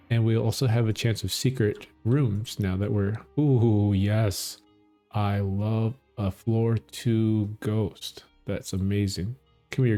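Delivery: usual speaking voice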